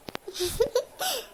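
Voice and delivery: short breathy giggle; a quiet shy laugh; soft laugh. Acted. Wife giggles softly to her husband, shy laugh.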